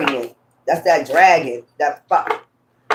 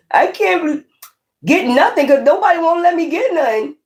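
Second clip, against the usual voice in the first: mock whiny complaint; 11 semitones higher than usual.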